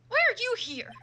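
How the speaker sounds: loud, high startled question; loud surprised challenge. Acted. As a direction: demand it in shock. Surprised at rival.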